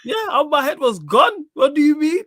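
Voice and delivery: high-pitched, worked-up outburst; exasperated protest. Real, not acted. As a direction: defend yourself hotly, pitch way up. Heated defence of his reaction.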